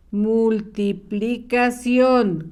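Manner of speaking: Multiplicación evenly broken into syllables; measured, drawn-out Multiplicación for pupils checking each letter; slow dictation. Shown as a demonstration. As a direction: spell out Multiplicación slowly by syllable.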